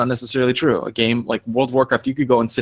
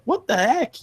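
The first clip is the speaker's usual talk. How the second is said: put-on upset little kid; 12 semitones higher, slower than usual. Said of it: "The four-year-old's like".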